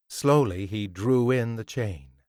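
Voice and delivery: ordinary delivery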